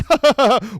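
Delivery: high, put-on chortle; mock rich-person laugh. Wealthy diner shrugging off spilled drinks, high mock laugh. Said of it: "They were so rich in that area".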